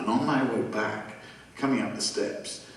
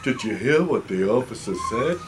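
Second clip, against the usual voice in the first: cartoonish toll man; 4 dB louder than usual. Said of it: "related to the guy who did the Yogi Bear thing".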